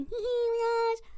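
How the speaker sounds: put-on high, giggly girl chatter; hammed-up high girlish tittering; stupid and girly. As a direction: giggle it in a silly girly squeal. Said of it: "being, like, stupid and girly".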